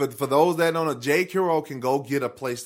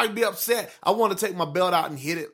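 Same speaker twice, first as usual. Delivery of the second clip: ordinary talk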